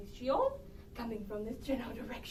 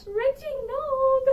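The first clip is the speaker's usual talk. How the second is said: loud, high, drawn-out call; 10 semitones higher, 11 dB louder, much slower than usual. Acted. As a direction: call him from offstage. Calling husband offstage.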